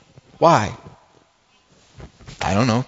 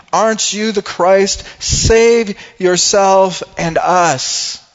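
loud, high, drawn-out taunt; hurled insult; 8 semitones higher, 6 dB louder, much slower than usual. Acted. Criminal on the cross insulting Jesus, loud and bitter. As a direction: hurl it out slowly at full voice. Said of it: "hurled insults on him".

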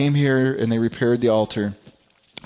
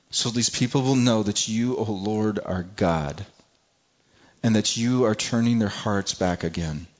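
lightly reverent prayer voice; 3 semitones lower than usual. Acted. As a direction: pray to the Lord humbly. Elijah's prayer read.

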